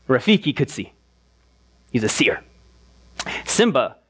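normal talking voice

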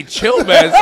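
high, laughing call to calm down; laughing plea. Retold or imitated. Friend laughs telling Stanley to chill, high laughing plea. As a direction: laugh while begging him to chill.

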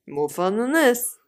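lightly voiced TV commentator, drawn out; slow, presenter-style delivery. Co-host echoing the other's line in a light commentator voice. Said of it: "is it like a commentator voice".

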